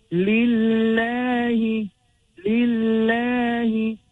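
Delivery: lillahi slow, long-held aa after a thin l; light lam. Shown as a demonstration. Teacher demonstrating the word Allah after kasra, lillahi drawn out. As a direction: stretch lillahi with a light doubled l. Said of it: "the word Allah subhanahu".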